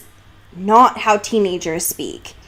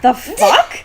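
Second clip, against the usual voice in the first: indignant Bella; 3 semitones higher, 7 dB louder, much slower than usual. Retold or imitated. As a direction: fire back at him half-laughing, appalled. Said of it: "And she's like".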